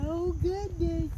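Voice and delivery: high-pitched soft coo. Real, not acted. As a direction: coo softly at the cat. Greeting a cat, baby talk.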